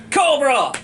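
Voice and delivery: imitated battle cry. Mocking the cartoon's Cobra base, mock yell. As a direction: call it out like a cartoon villain's base. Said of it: "the Cobra base is just".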